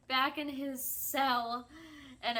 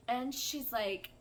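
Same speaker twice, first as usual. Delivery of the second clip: ordinary delivery, nothing marked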